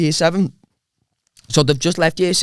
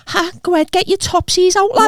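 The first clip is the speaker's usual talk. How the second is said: put-on high teasing kid voice; mocking schoolyard taunt; 12 semitones higher than usual. Lad imitating school kids taunting him in the yard, high and teasing. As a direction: jeer it like a school bully. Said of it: "they'd go".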